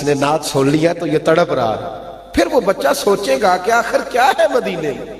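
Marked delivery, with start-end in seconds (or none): 2.3-5.1 high, impassioned sermon climax; high-pitched fervent preaching; preaching at full force. Acted. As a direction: drive the congregation with rising fervour.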